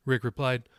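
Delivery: ordinary delivery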